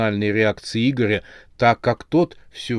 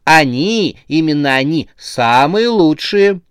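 higher, louder, drawn-out salesy praise; gleeful insistence; 5 semitones higher, 6 dB louder than usual. A narrator's reading. Saleswoman eagerly confirms the free heads, gleefully. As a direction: gush over the goods, stretching the superlative. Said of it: "радостно закивала".

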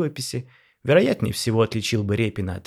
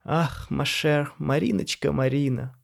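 very slow, higher, stretching the name; drawn out; 5 semitones higher, slower than usual. A narrator's reading. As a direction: linger on each name, flirting. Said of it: "протянул Пётр".

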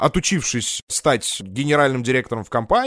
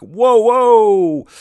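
excited whoa; 8 semitones higher, 8 dB louder than usual. Pretend. Hyped teleshop exclamation.

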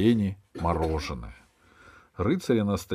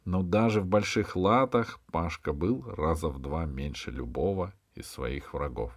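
ordinary delivery

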